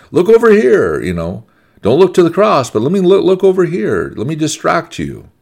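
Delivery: very high, loud mock distraction; tempting world. The world pulling eyes off the cross, mock urgent luring. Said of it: "this world wants to keep you ignorant".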